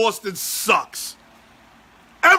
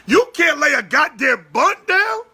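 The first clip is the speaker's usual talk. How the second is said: normal talking voice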